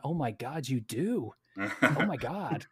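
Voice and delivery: swooping mock American astonishment, half laughing; hammed-up starstruck tourist; imitated amazement. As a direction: gush in disbelief, drawing out the last words. Imitates amazed Americans hearing his accent.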